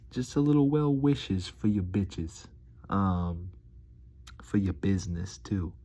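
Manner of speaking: ordinary delivery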